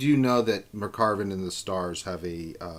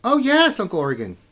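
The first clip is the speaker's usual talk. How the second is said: put-on high, bright girlish voice; an eager young niece; 12 semitones higher, 9 dB louder than usual. Young niece greets her uncle.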